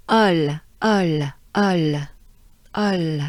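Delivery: ol cut short, not sustained; quick short ol. Shown as a demonstration. Teacher drills short French ol. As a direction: clip each ol quickly. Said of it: "it ends fast".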